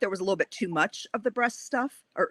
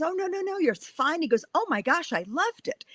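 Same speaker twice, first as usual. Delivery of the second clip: high-pitched imitation of delighted praise; retold gushing reassurance; 10 semitones higher than usual. Editor reassuring an apologetic writer, imitated gushing reassurance. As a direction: wave off the apology, pitched well up.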